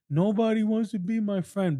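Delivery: high-pitched imitation of a child's complaint; lonely little kid. Little boy complaining to mom, high child's voice.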